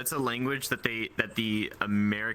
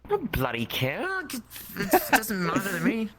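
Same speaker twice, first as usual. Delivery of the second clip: hammed-up British indifference; British accent; 8 semitones higher than usual. Brushing off the warning about sounding British, mock British accent. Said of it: "everyone is gonna think you're British".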